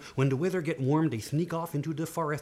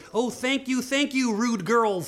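high, gushing thanks; grateful king; 8 semitones higher, slower than usual. Acted. Freed king thanking the rude girls, high joyful gratitude. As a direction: exclaim it with raised pitch.

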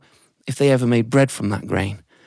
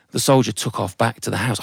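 ordinary delivery, nothing marked

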